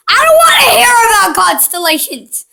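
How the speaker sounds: high, loud annoyed yell; frustrated shout. Real, not acted. Kid snaps at the owl about constellations, high fed-up yell.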